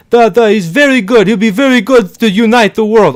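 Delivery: high, loud put-on Russian accent; mock Russian accent. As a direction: ham up a Russian citizen in a thick Russian accent. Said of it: "they're like".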